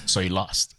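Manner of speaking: flat dad voice. Retold or imitated. Dad unimpressed by son's loss, low deadpan. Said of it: "And then he's like".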